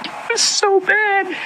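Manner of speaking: barely getting it out through tears; choked up. Real, not acted. Overwhelmed after telling the vision, tearful and faint. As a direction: voice breaking high and faint, slow. Overcome recalling hell.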